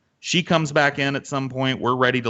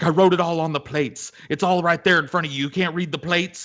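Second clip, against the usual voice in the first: higher-pitched, exasperated waitress imitation; heated spat; 7 semitones higher than usual. Waitress arguing back at the cook, exasperated. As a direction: argue back in quick frustration, as retold. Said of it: "heated little spats".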